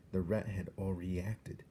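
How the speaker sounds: ordinary delivery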